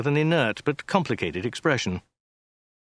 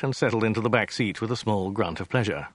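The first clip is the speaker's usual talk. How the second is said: ordinary delivery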